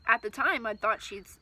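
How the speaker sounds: ordinary delivery, nothing marked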